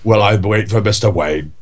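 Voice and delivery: idle rambling in a mild Michael Caine voice; Michael Caine impression. Podcast host orders his last meal in a mild Michael Caine voice. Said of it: "I just have to talk like him".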